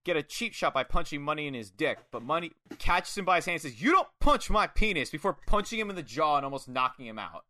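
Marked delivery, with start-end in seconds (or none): 3.8-5.1 louder, higher, emphatic rebuke; mock indignation. Money catching Rishi's cheap shot, indignant rebuke. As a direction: scold firmly, hammed-up outrage.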